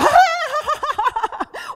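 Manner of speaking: huge, high villain cackle; booming mocking laugh. Goliath laughing at little David, loud mocking laugh. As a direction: laugh loud and long at a puny foe. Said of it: "si Goliat ketawa".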